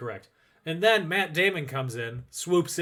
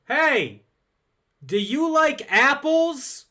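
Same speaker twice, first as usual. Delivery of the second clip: Boston guy taunt; 11 semitones higher, 6 dB louder, much slower than usual. Retold or imitated. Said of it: "he goes".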